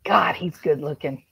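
normal talking voice

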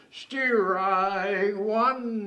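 loud, drawn-out umpire's strike call; ringing ballpark call. Acted. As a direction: bellow it high and long like an umpire. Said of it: "the umpire said".

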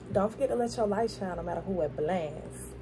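usual speaking voice

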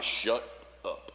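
low, hushed command, words set apart; Jesus. Acted. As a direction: quietly order silence, pausing between the words. Voicing Jesus confronting the spirit.

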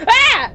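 high, loud startled cry; startled yelp. Acted. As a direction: jump at a sudden fright. Character startled while reading.

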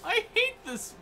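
very high mock despair; high, hammed-up lament; Don Knotts. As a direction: whimper as a miserable nervous comic. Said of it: "I think Don Knotts is".

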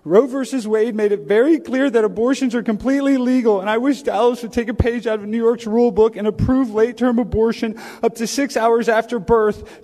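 brisk, insistent read-out statement; formal speech. Pretend. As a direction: press your case to the council at a quick clip. Continues the prepared council speech.

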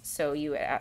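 usual speaking voice

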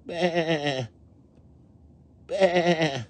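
high, tremulous little bleats; lambs bleating. A narrator's reading. Hear those lambs, high quavering bleats. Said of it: "Hear those lambs".

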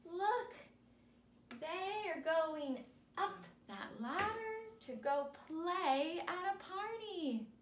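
slow, high, sing-song picture-book reading; animated storybook voice for young kids; reading to children. Acted. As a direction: read it big and bright for little listeners. Reads picture book to students.

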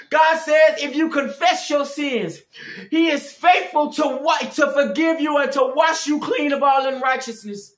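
high, loud, urgent preaching cadence; full-force preaching. Real, not acted. Preacher pleading with listeners to come back to God, impassioned preaching. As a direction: plead with the congregation from the pulpit.

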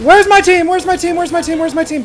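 loud, high desperate calling; panicked shouting. Real, not acted. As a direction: yell for help in panic. Alone in fight, calling team.

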